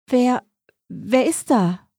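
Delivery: scared stammer into the dark; trembling voice. A narrator's reading. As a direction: falter on the first word, then ask fearfully. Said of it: "Ihre Stimme bebte".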